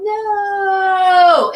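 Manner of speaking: high, loud panicked yell. Retold or imitated. Sinking in the water, yelling for help. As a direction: shout it out as if going under. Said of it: "And I yelled".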